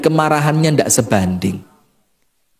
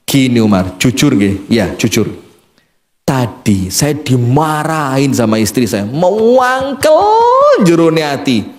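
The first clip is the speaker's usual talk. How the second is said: low, sheepish confession; a cowed man admitting his shame; meek husband; 5 semitones lower than usual. Acted. Husband sheepishly confessing to Umar in a low timid voice.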